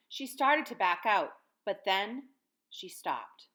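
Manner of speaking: usual speaking voice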